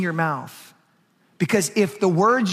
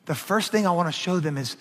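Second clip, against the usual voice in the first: ordinary delivery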